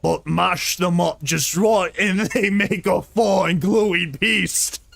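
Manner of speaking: slow, rough orc growl. Acted. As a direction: give him a slow monster growl with laughs breaking in. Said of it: "I'm gonna make him sound like an orc".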